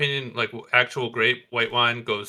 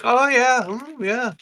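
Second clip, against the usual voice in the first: higher, hammed-up fake relish; pretending to enjoy; 11 semitones higher, slower than usual. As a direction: act like it's delicious when it isn't. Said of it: "you pretend to be like".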